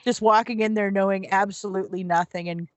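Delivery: ordinary talk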